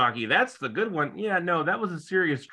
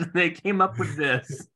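ordinary talk, nothing marked

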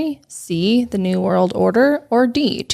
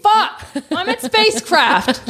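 frustrated outburst; 11 semitones higher than usual. Real, not acted. Contestant yells after losing the question, frustrated. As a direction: blurt it out, annoyed at yourself. Contestant loses on wrong answer.

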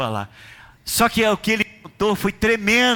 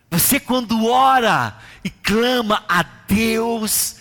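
full-force preaching; 4 semitones higher than usual. Acted. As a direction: build it up like a revival preacher.

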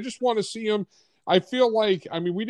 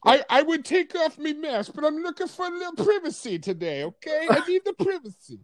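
much higher, put-on English voice; prissy English hero asking for privacy; odd British accent; 9 semitones higher than usual. British Batman refusing to remove his mask. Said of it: "I don't know what accent that is, but it's pretty good".

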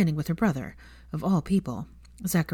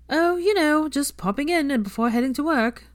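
breezy, high and louder, brushing the question off; evasive; 10 semitones higher, 5 dB louder than usual. Acted. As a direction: dodge the question with forced lightness. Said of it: "said Veronica evasively".

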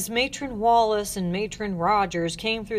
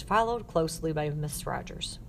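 usual speaking voice

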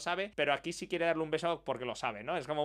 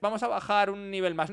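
usual speaking voice

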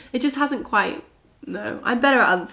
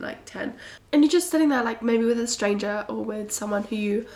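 ordinary talk, nothing marked

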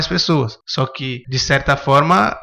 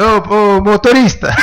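calling out; 7 semitones higher, 6 dB louder, slower than usual. Retold or imitated. Calling out to the bus driver.

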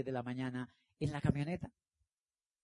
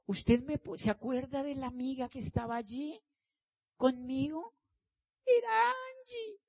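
soft and sweet; 10 semitones higher, 6 dB quieter than usual. Retold or imitated. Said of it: "así suavecito".

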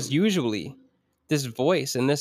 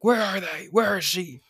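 high, hammed-up gravelly-free Batman voice; mock dark-hero growl, pitched up; terrible Batman impression; 7 semitones higher than usual. Host clowning about interrogating yourself, mock Batman voice. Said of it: "That was a terrible Batman impression".

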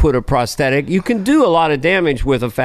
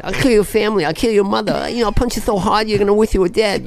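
high-pitched boxer making threats; higher, menacing pre-fight trash talk; Mike Tyson; 6 semitones higher than usual. Acted. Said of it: "I'm going into Mike Tyson".

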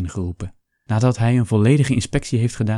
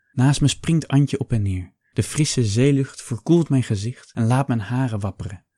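ordinary delivery, nothing marked

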